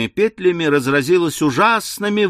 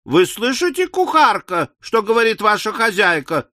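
high, insistent scolding of a servant; indignant chorus; 8 semitones higher than usual. A narrator's reading. Three teachers chiding the cook, indignant. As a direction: raise the pitch and demand an answer.

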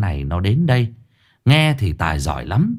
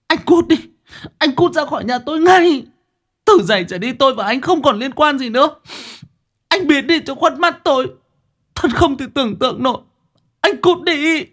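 furious woman. Acted. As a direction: throw him out of the house in a rage. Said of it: "cô Nguyệt nổi giận đùng đùng".

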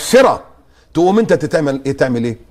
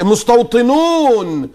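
higher, very slow word; drawn out; 7 semitones higher, much slower than usual. Shown as a demonstration. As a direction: stretch the word out. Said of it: "slow emphasis on label".

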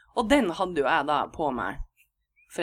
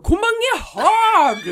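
much higher and louder, slow, laughing shout; roper ut; 14 semitones higher, 9 dB louder, much slower than usual. Retold or imitated. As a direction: shout the question across the hallway through laughter. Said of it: "Roper hun ut". (roper høyt og leende med mye høyere stemme)

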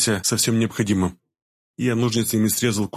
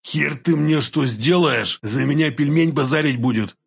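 drawn-out, higher convict taunt; ex-convict's defiance; 4 semitones higher, slower than usual. A narrator's reading. Pinned ex-convict defies his captor, drawling and higher-pitched. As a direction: spit defiance like a cocky convict, stretching words. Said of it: "ответил бывший зэк".

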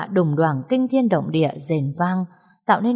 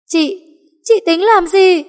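terrified shriek; 13 semitones higher, 9 dB louder than usual. Acted. Terrified at the knife.

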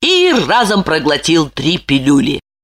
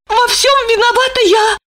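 anxious wife. A narrator's reading. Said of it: "решила она".

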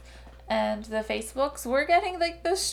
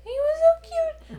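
high, slow gush; 8 dB louder, slower than usual. Real, not acted. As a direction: coo over something cute. Gushing over chimp.

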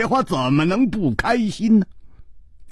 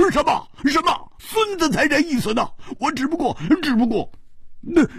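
higher, agitated, tripping over his words; flustered protest; 10 semitones higher than usual. Acted. Hu Tiehua, stung by Ji's teasing, sputters in a flustered rush. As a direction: sputter it out in a panic. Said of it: "胡铁花着急地说".

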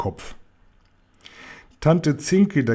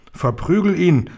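higher-pitched aunt egging her on; half-shouted order in a raised pitch; mild yell; 6 semitones higher, 4 dB louder than usual. A narrator's reading. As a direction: cry it out without full force. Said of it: "schrie Tante Zwamm".

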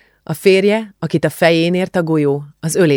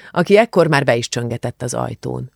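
ordinary delivery, nothing marked

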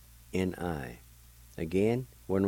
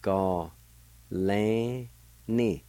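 Ga and lan held long and set apart, ni short; syllable by syllable; much slower than usual. Shown as a demonstration. Teacher showing the word for "his or her ear", syllable by syllable. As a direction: sound out Ga, lan, ni with pauses. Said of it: "it's pronounced like this".

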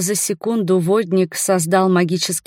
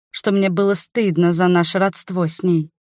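plain reading voice

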